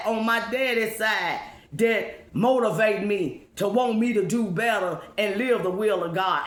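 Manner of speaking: normal talking voice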